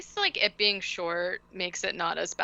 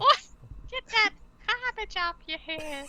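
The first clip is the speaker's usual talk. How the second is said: quick hammed-up New Zealand accent, higher; a mock Kiwi shout-out, pitched up; 13 semitones higher than usual. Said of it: "do it in, like, a New Zealand accent".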